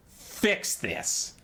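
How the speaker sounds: breathy unvoiced rat-man command; whispered Skaven hiss. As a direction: hiss the order like a sly rat creature. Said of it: "and they say".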